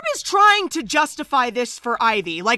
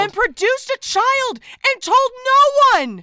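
high, rising cry of outrage; scandalized outburst; 10 semitones higher, 3 dB louder than usual. Pretend. Host recaps the secret child, scandalized. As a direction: build to an appalled high peak.

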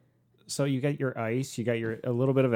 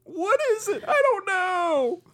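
very high baffled squeal; high confused voice; 4 dB louder, slower than usual. Acted. Acting out puzzled Riddler drinker.

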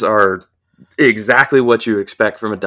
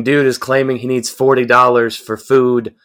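ordinary talk

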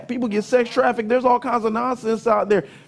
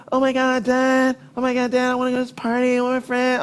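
whiny daughter; 3 semitones higher, faster than usual. Retold or imitated. Daughter begging dad.

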